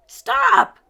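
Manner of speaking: very high, very loud yell; vampire's shout. A narrator's reading. Vampire chasing Shaggy and Scooby, a very high loud yell. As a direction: bellow it down the hall. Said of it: "cried the vampire".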